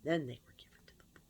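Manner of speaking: fast, whispered ironic aside; quick whisper. Real, not acted. Explaining what happened to the donated clothes, in a quick ironic whisper. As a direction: rush it out under your breath.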